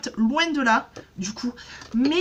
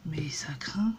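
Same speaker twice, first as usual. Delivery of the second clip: whispered aside; 7 semitones lower, 6 dB quieter, slower than usual. Real, not acted. Private aside about the price.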